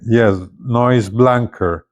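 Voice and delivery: normal talking voice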